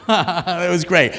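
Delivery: real laugh into loud praise. Real, not acted. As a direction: praise them through your laughter. Amused by audience's seductive take.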